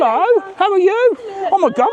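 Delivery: high, thrilled hello; excited greeting. Real, not acted. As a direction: jump up in pitch to welcome them. Greeting new fans arriving.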